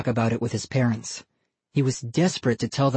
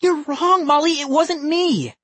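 much higher, louder frantic plea; desperate plea; 14 semitones higher, 3 dB louder than usual. A narrator's reading. Ian pleads innocence to his sister, frantic and high. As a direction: beg her to believe you.

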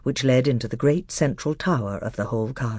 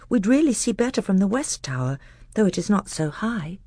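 put-on higher, eager boy's voice; a young prince speaking eagerly; 7 semitones higher than usual. A narrator's reading.

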